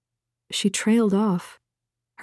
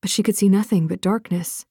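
plain reading voice

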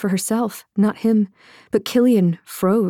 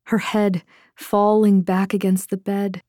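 ordinary delivery, nothing marked

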